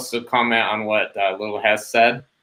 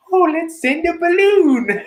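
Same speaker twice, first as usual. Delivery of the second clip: much higher, airy mock-innocent voice; mock naive voice; 4 dB louder than usual. Mocking China's balloon plan to co-hosts, in a much higher mock-naive voice. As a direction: play it like a silly, cheerful idea.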